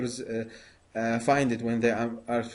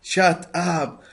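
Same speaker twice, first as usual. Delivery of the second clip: raised, higher and louder command; loud inner voice; 6 semitones higher, 5 dB louder than usual. Shown as a demonstration. Meditator telling his chattering mind to stop, loud. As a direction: call it out to silence your own mind. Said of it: "in a very loud, uh, in your voice".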